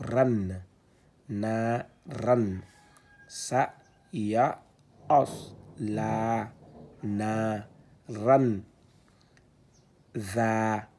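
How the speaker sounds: syllabes détachées, plus fortes; lecture syllabée. Shown as a demonstration. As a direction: scande chaque syllabe. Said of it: "c'est un za avec l'outil d'allongement". (« Wa, ma, ka, sa, ba » récité syllabe par syllabe)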